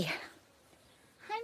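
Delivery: ordinary talk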